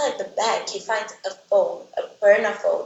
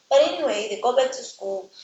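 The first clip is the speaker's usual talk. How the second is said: ordinary talk, nothing marked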